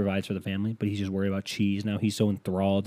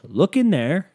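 high, loud, thrilled; proud husband; 10 semitones higher, 9 dB louder, slower than usual. Acted. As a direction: show her the miracle. Husband shows wife.

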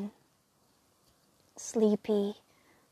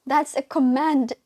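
louder, higher plea; pleading; 4 semitones higher, 8 dB louder than usual. Acted. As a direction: make the order a plea. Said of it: "her voice was pleading".

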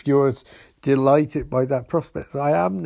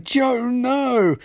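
alarmed cry; 10 semitones higher than usual. Retold or imitated. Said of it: "Sisko's like".

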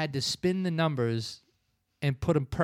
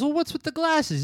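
high mock mom voice, questioning; suspicious mom; 11 semitones higher than usual. Mother questioning her son's glasses, lightly voiced high mom. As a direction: pitch it up like a prying mother. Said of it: "She goes".

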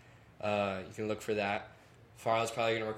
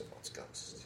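hushed mock-spooky voice; soft, whispery joke about spooks; joking spooky quote; 12 dB quieter, slower than usual. Family joking about the shaking doors, mock-spooky hush. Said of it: "we make jokes".

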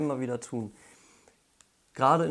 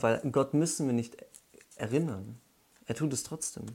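normal talking voice

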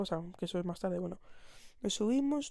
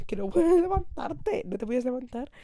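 mock alarmed shout; 4 semitones higher, 6 dB louder than usual. Friends shouting at her on ride.

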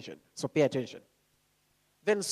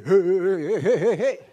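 loud bouncing excited heys; laughing, pulsing burst of heys; hyped-up preacher; 7 dB louder than usual. Acted. Preacher mimicking livelier preachers for the congregation, hyped-up and loud. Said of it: "others might be very".

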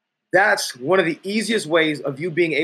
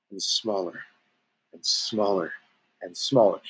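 shrinking away; 9 semitones lower, 6 dB quieter than usual. Shown as a demonstration. Demonstrating feeling small under group pressure, fading away. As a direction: voice sinking lower and softer each time. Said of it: "start feeling like you wanna start getting smaller".

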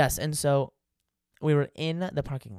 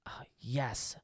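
whispered; 9 dB quieter than usual. Acted. Whispered aside.

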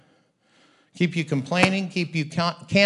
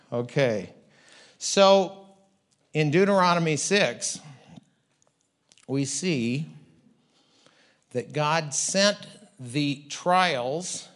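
ordinary talk, nothing marked

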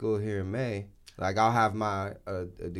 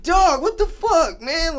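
higher and louder, slow disbelief; shocked scolding; 11 dB louder, slower than usual. Retold or imitated. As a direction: scold the kid in stunned surprise. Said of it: "I'm like".

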